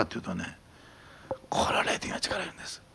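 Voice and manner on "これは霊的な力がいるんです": sudden soft whisper. Acted. Pastor confiding to the congregation, hushed whisper. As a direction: lean in and breathe it. Hushed aside after loud preaching.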